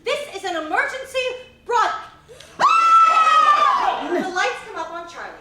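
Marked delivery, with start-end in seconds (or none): 2.6-4.1 sudden very high shrieked scream. Acted. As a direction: cut off by a piercing scream. Scream as emergency cuts off.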